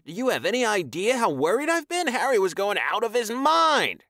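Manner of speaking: high, loud upset tirade; worried scolding. Acted. Corey scolding Taion about worrying, high and loud. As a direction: let the worry pour out as anger.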